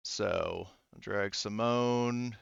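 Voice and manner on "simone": usual speaking voice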